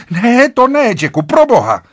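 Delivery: loud, very high agitated cry; frantic shriek. A narrator's reading. Wife stops husband going in, frantic high cry. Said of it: "vyjekla v neovladatelném vzrušení".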